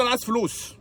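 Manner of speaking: lightly voiced greedy attendant; demanding parking attendant. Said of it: "فبيبص لي كده يقول لي".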